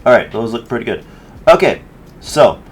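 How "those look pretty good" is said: ordinary delivery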